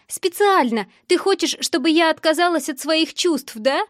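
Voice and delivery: high-pitched, accusing outburst; upset cry. A narrator's reading. Girl on the phone to the boy, crying out accusingly. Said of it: "закричала она".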